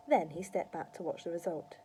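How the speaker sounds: usual speaking voice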